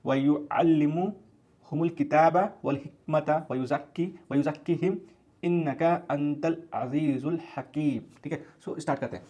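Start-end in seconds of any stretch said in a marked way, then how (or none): 0.1-8.2 slow, drawn-out chanted Arabic recitation; Quran recitation. Shown as a demonstration. Teacher recites the Quran verse for his students, slow measured chant. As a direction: recite the verse slowly, stretching every vowel. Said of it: "मैं एक बार Arabic में go through करता हूँ".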